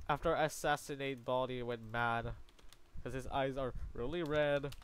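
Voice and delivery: usual speaking voice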